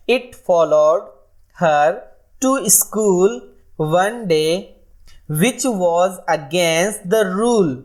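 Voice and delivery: very slow, sing-song nursery rhyme recital; slow rhyme reading. Shown as a demonstration. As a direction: chant it out line by line for children. Reads nursery rhyme to class.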